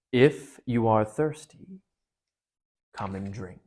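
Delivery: slow, heavy lion voice sinking at the end; a hint of a deep, calm lion; lion's golden voice. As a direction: let the lion speak slowly and gravely. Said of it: "a deeper, wilder, and stronger, a sort of heavy, golden voice".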